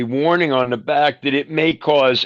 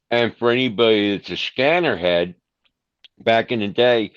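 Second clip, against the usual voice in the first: normal talking voice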